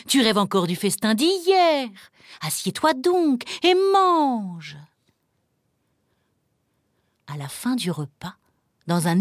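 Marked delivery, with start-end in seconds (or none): 0.1-4.8 impatient, singsong nagging; wifely scolding. Acted. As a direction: chide a husband and order him to the table. Wife scolds the suspicious ogre.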